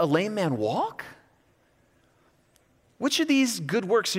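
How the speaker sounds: high, fast, mocking disbelief; preacher voicing Jesus taunting his accusers, sarcastic. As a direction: needle them with sarcastic questions. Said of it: "a little sarcastic".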